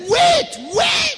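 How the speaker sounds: very high, loud shouted command; urgent shout. Real, not acted. Preacher urging the church to wait on God, shouting. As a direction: yell it like a plea to hold on.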